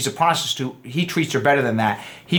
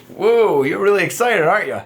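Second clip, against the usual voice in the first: loud amused whoa, then a playful question; gentle teasing; 4 semitones higher, 4 dB louder than usual. Shown as a demonstration. Man kindly teasing the girl about her excitement. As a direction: tease her kindly, not mean. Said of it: "Not in a mean way".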